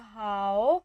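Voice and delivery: हाउ with a dipping third tone. Shown as a demonstration. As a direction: dip हाउ low and stretch it. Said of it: "इस पर भी third tone use हो रही है". (तीसरी tone में हाउ)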